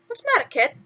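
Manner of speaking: chirpy sidekick asking what's wrong; a hint of cartoon mouse; lightly voiced mouse. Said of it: "Timothy asked".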